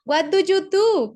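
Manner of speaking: slow, emphatic teacher's model of "What do you do?". Shown as a demonstration. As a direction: call out "What do you do?" to the class.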